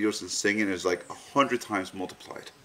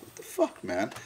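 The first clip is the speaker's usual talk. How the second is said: squeaky disbelief; 6 dB quieter than usual. Retold or imitated. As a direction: squeal it out like you can't believe it. Retold frustration to friend, wife.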